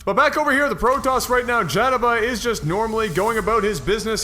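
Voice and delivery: ordinary talk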